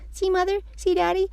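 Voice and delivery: put-on high, thrilled kid calling. A narrator's reading. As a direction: call proudly to your parents. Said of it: "I called".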